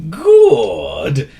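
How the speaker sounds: high, loud "Good"; an old wizard's pleased wheeze; wheezy approval. A narrator's reading. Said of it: "wheezed the wizard".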